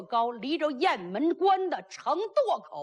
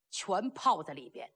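usual speaking voice

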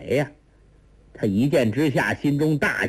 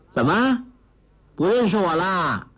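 put-on higher girlish voice, playful question; teasing young girl; 6 semitones higher than usual. A narrator's reading. Girl teases stunned Guo Jing, playfully smiling. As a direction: tease him for not recognising you. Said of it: "那少女笑道".

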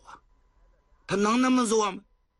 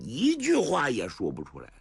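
ordinary delivery, nothing marked

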